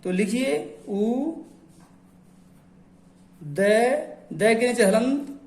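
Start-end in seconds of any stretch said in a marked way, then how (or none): none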